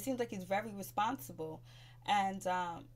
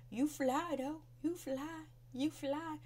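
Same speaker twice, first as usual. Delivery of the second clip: higher, sing-song mock compliment; mock sassy compliment; 7 semitones higher, slower than usual. Imitating Anais flattering Ashley, mock sing-song. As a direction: coo it like a sly backhanded praise.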